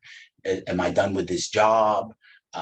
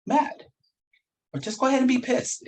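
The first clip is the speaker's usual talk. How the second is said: lightly teasing, much higher on "mad"; mock-indulgent go-ahead, pitch jumping high; teasing permission; 5 semitones higher than usual. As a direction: egg yourself on playfully, voice leaping up. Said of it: "And then I go".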